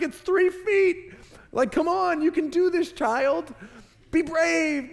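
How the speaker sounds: very high-pitched, strained pleading; exasperated coaxing dad. Retold or imitated. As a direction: urge a scared child on in a high, incredulous voice. Exasperated dad urging his son.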